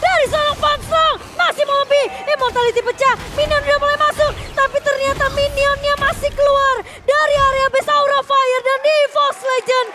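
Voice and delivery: loud, fast hype esports call; excited play-by-play. Real, not acted. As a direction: call the teamfight at full hype.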